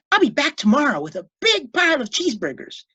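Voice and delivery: put-on high, eager boy's promise; a cheerful kid making a promise; promising boy. A narrator's reading. Boy promising his pet food, high and eager. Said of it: "he promised".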